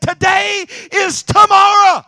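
triumphant high shout; joyful shout. Retold or imitated. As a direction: proclaim it like the best news ever. Famous line shouted in joy.

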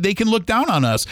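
normal talking voice

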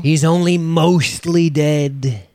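high, drawn-out put-on voice; theatrical quote. Co-host quoting a film line to open, theatrically drawn out. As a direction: stretch it out slowly in a high quoting voice.

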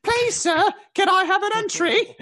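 high pleading beggar voice; begging orphan. Pretend. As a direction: beg in a squeaky little plea. Begging like Oliver Twist.

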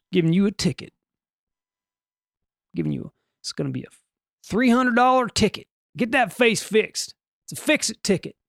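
high, hammed-up Southern cop voice; mock Southern traffic cop; botched Southern accent. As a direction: hand out a fine in a bad Southern accent. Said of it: "I turned it into a Southern accent".

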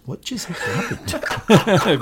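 slow, laughing mock bewilderment; mock confused. Colin stunned by the roast, slow and laughing. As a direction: drag it out, baffled and amused. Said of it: "Colin looks around and goes".

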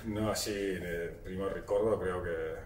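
ordinary delivery

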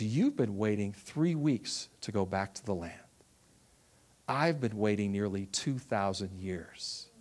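voice of the Lord. Retold or imitated. The Lord answers the woman longing for the land, low and solemn. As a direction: speak as God, gently rebuking from afar.

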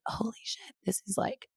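hushed, shocked holy shit; shocked whisper. Retold or imitated. Realising she is bleeding mid-deadline, shocked whisper. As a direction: drop to a whisper in disbelief.

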